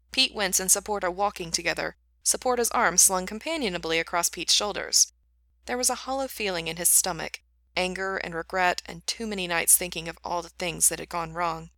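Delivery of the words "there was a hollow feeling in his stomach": ordinary delivery, nothing marked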